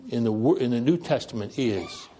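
usual speaking voice

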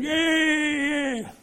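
high, drawn-out mock yeah; mocking agreement. Imitating non-Christians cheering along, high and mocking. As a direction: mimic smug onlookers agreeing. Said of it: "all the non-Christians are going".

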